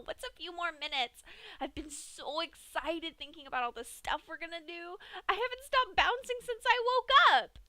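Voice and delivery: very high and loud, giggly and reassuring; gleeful Pinkie. A narrator's reading. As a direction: gush with glee, bouncing on the spot. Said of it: "in a gleefully reassuring tone".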